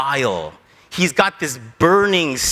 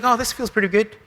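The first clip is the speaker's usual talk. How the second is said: mock contentment; 3 semitones higher, faster than usual. Jonah enjoying the shade, mock contentment. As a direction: lightly play someone settling into comfort.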